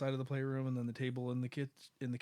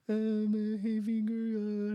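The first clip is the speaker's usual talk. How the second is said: put-on higher, slower whimpering child voice; hurt little kid; 7 semitones higher, 4 dB louder, slower than usual. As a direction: play the son whining about his sore finger. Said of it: "And he's like".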